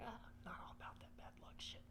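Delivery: breathy near-whisper, brushing it off; dismissive whisper. Retold or imitated. To coworker about the feather, a scoffing whisper. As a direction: hush it like a scoffing aside.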